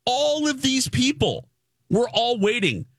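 acted outrage, high but not shouted; indignant protest. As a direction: speak up for the whole line, pitched high. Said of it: "I went like this".

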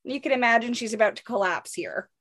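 usual speaking voice

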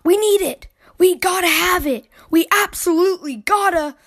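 high, urgent pleading; excited gasp. A narrator's reading. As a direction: beg like a child who simply must have it. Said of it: "all excited and so out of breath".

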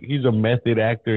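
ordinary delivery

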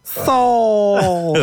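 cartoon hero call.

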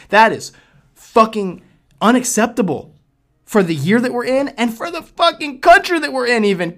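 high, loud furious rant; angry rant. Real, not acted. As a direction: explode at the country.

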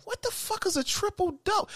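high, baffled question; mock confused squeal. Man retelling his own confusion over basketball terms, high and baffled. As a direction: squeak it out in disbelief. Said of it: "I'm like".

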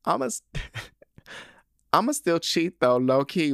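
giggling as she rereads it; laughing through the words. Real, not acted. Podcaster laughing at a cheeky email line.

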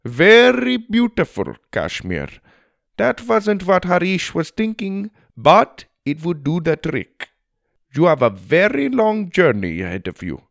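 put-on musing aloud in an Indian accent; friendly Indian elephant rider. A narrator's reading. Elephant rider sending travelers to Kashmir, Indian-accented musing.

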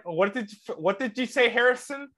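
high-pitched teasing speech-impediment imitation; mocking broken-tooth speech. Friend making fun of Harrison's broken tooth, impediment imitation. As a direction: tease him with a put-on gap-tooth voice. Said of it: "people that talk like that".

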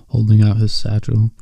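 ordinary delivery, nothing marked

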